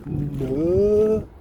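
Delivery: lightly mocked Yorkshire "No"; a hint of a Yorkshire "No"; family from Yorkshire saying "No". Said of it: "they're always like".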